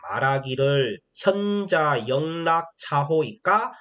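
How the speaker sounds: slow, measured reading of classical Chinese; teacher reading a Mencius passage aloud for the class; classical recitation. Shown as a demonstration. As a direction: chant each phrase slowly like a lesson text. Reads classical text aloud.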